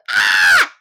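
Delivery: harsh, loud low crow caw. Retold or imitated. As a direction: caw like a crow to wake everyone. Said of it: "就会这样去叫每个人".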